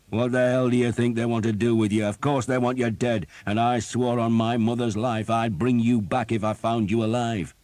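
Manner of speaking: mock him without mercy; captain mocking a doomed archer; sarcastic scorn. A narrator's reading. Sarcastic tirade at Thomas.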